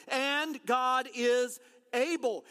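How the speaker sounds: And God is able, slow, ABLE punched; slow emphatic refrain. Shown as a demonstration. Pastor preaching freedom from addiction, slow emphatic refrain. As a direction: hammer home ABLE. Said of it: "there is freedom".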